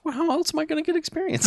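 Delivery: high mocking voice. Mocking the church's logic.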